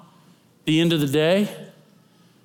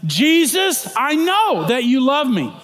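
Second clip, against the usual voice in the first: proclaiming aloud; 9 semitones higher, 4 dB louder, slower than usual. Shown as a demonstration. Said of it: "Try it again".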